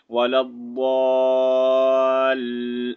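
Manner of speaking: ولا الضال with a slight stop on the lam, drawn out slowly; demo of a held stop. Shown as a demonstration. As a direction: pause briefly on the lam in الضال, then release it. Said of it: "یہاں پر لام کے جو ہے تھوڑا سا ہم رک کر".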